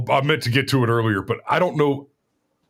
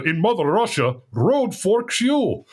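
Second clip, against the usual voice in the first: exaggerated Russian accent, higher and stretched out; mock Russian accent; 6 semitones higher, slower than usual. Soldiers teasing their Russian buddy in a bar, mock Russian accent. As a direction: tease with a put-on Russian accent. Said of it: "teasing him about the accent a little bit".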